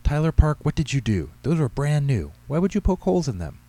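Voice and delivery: high, loud scolding mother; exasperated scolding. A narrator's reading. Mother scolding her son over new shoes, exasperated. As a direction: tell off a child in exasperation.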